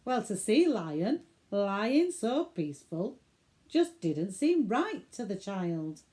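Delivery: ordinary delivery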